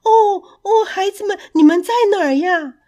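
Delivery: high, loud searching call. Acted. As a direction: call out across the hills for lost children. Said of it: "他们大声地喊着".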